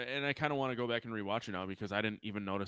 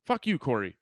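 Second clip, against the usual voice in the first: loud playful jab; mock annoyance; 4 semitones higher, 9 dB louder than usual. Co-host teased about misquoting, loud mock annoyance. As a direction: snap back in mock offence.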